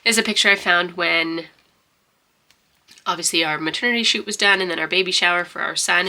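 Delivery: ordinary talk, nothing marked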